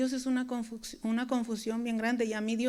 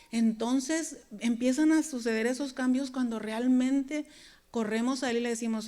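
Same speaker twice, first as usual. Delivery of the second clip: ordinary delivery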